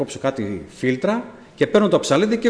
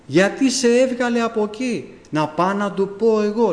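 higher, slower, aggrieved brother; indignant brother; 8 semitones higher than usual. Retold or imitated. Brother vowing to confront the pastor, higher and indignant. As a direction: offer to go set the pastor straight.

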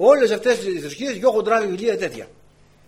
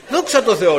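laughing thanks; 6 semitones higher, 9 dB louder than usual. Retold or imitated.

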